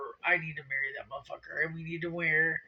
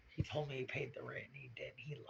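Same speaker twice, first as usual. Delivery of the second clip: hushed whisper, nearly no voice; 4 semitones lower, 13 dB quieter than usual. Real, not acted. Whispering to viewers about the unpaid rent. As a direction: whisper the betrayal like a secret. Secret grievance about boyfriend.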